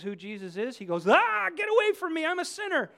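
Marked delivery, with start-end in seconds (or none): 1.1-2.9 higher and louder, recoiling cry; terrified Peter. Retold or imitated. Peter fleeing Jesus, higher and louder cry. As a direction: shrink back in fear. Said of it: "He's terrified of him".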